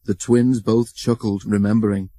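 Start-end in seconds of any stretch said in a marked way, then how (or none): none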